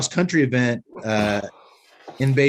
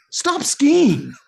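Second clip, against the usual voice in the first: exasperated punchline; 12 semitones higher than usual. Acted. Deadpan advice punchline.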